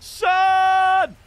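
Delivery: drawn-out hype yell; excited shout. Real, not acted. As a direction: scream the player's name as he walks out. Pack walkout excitement, shouting player name.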